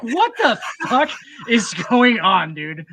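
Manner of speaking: high, loud and slow, stretching out the disbelief; baffled outburst. Retold or imitated. Recalling a Monty Python movie, a baffled, drawn-out outburst. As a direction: replay the total confusion of that first viewing. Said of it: "And I was like".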